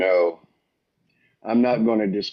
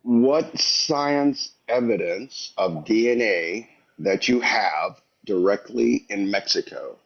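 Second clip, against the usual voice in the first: slow, measured question; slow, poetic talk; slower than usual. Real, not acted. Debate caller challenges the host for DNA evidence, slowly. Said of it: "He's talking too slow for me".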